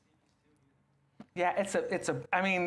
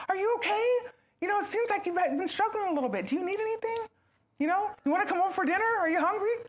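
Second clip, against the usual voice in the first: caring fuss; 13 semitones higher, faster than usual. Pretend. Fussing over a struggling friend, high caring voice.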